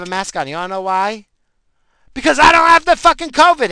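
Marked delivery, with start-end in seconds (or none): 2.1-3.7 loud, high, furious burst; angry shout. Real, not acted. Ranting at mask-policing Karens, an angry shout. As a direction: yell it out in outrage.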